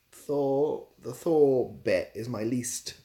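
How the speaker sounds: ordinary talk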